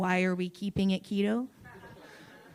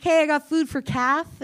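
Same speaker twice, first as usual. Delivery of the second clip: higher, singsong order call; server calling out; 7 semitones higher, 3 dB louder than usual. Acted. Server calling Kath for food, high call-out. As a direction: call an order across the shop.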